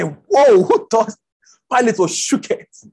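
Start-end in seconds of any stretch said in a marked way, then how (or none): 0.0-1.1 amazed. Retold or imitated.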